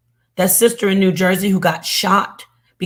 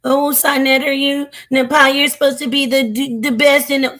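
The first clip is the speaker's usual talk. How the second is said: mocking whine; 6 semitones higher than usual. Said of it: "Everybody always over here with their".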